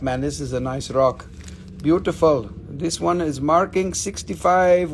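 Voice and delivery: ordinary talk, nothing marked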